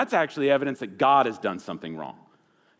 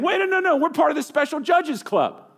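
much higher, frantic protest of the condemned; panicked guilty plea; 5 dB louder than usual. Pretend. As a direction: beg the judge in a squeaky panic. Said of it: "Even as they're saying".

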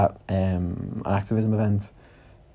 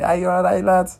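higher cheerful Scouse "all right, lad"; Scouse greeting; 9 semitones higher than usual. Retold or imitated. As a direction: greet him like a friendly Scouser. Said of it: "he's like".

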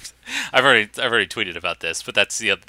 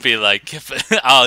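normal talking voice

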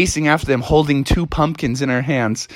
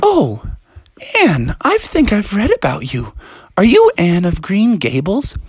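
raised little-sister voice; curious little sister; 5 semitones higher than usual. Acted. Little sister asks Anne who she is, high and curious. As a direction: recognize someone, pitched up and wondering.

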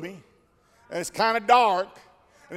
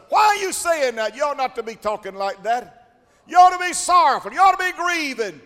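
scolding critic; 6 semitones higher, 5 dB louder than usual. Retold or imitated. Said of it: "some folks that'll tell you".

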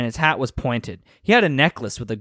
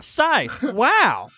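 put-on kid voice; 9 semitones higher than usual. Kid voice reacting in story.

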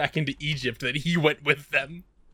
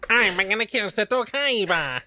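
high-pitched silly villain threat; mock cartoon villain; 8 semitones higher than usual. Podcaster playing Merrick as Waluigi, hammed-up threat.

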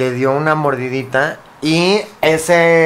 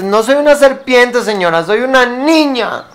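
high, slow, offended protest; indignant girl; 7 semitones higher, 5 dB louder, slower than usual. Acted. Giant Alicia protests to the lady, high and indignant. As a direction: insist hurt and cross that you are a girl. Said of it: "se enojaba porque le dice".

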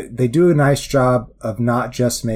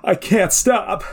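high panicked speedster; 8 semitones higher than usual. Retold or imitated. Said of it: "And he's like".

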